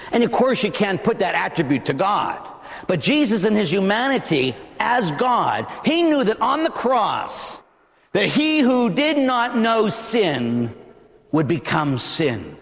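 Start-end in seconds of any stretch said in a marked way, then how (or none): none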